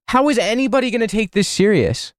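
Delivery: high-pitched disbelief; incredulous exasperation. Real, not acted. Streamer reacting to the incident report, incredulous. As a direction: ask it as an exasperated, high-voiced plea.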